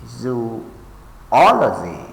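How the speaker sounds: zo-OL-ogy, drawn out and leaning on OL; stress on correct syllable. Shown as a demonstration. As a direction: say zoology stressing the second syllable. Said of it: "mark the stress on the correct syllable".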